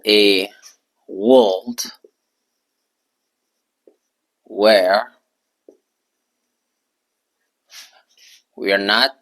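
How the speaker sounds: each word said alone, with long gaps; slow dictation. Shown as a demonstration. Teacher dictating to students, slow and separated. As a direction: spell it out word by word for the board. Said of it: "Je vais mettre ça au tableau".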